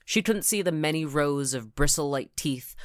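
plain reading voice

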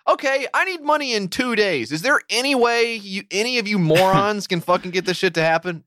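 fed-up customer needling the bank; exasperated demand. Retold or imitated. Mock rant at bank staff.

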